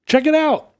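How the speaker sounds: high, excited gawker voice; excited onlookers. Retold or imitated. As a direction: gasp at it like a thrilled crowd. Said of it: "everybody's like".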